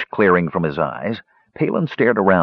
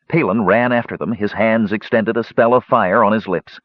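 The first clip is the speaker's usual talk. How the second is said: plain reading voice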